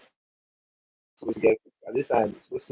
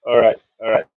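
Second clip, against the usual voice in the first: ordinary delivery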